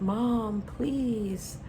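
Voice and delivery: imitating a kid calling for mom; mock cry for help. Drowning kid pleading with mom for help, imitated cry. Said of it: "he starts crying for help".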